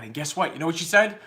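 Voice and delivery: ordinary delivery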